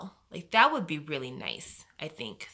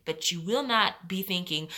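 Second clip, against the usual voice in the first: normal talking voice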